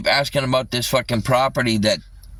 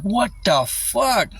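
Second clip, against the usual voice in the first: high, drawn-out mock disbelief; retold disbelief; 8 semitones higher, slower than usual. Streamer retelling reaction to extreme heat, exaggerated disbelief. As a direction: re-enact being floored, stretched out high.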